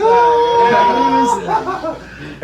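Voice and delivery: big shrieking laugh; loud laughing shout. Real, not acted. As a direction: bursts out laughing. 친구가 놀리자 크게 웃음.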